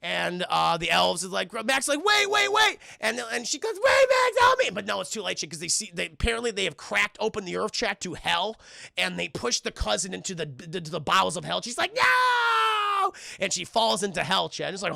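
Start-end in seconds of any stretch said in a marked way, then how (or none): 2.0-2.8 high, loud repeated shout; hammed-up panicked halt; mock urgent plea. Max tries to stop the elves. 3.8-4.7 very high, loud shriek; hammed-up victim screaming for rescue; mock cry for help. Cousin calls out for help. 11.9-13.1 long, high, loud wail; hammed-up cry of someone plunging away; falling scream. Cousin falls into hell.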